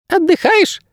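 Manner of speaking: very high squeaky little creature; thin squeak. A narrator's reading. Bird guide greeting his ward, thin squeak. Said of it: "пискнул знакомый тонкий голос".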